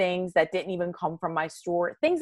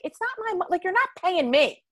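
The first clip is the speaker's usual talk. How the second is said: pitched way up, indignant disbelief; exasperated retort; 12 semitones higher than usual. Retold or imitated. Parole officer to parolee refusing restitution, exasperated. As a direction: throw it back at him, incredulous and high.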